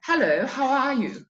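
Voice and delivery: high, unhurried posh accent; posh Queen's English. Shown as a demonstration. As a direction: draw it out in polite Queen's English. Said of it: "We say".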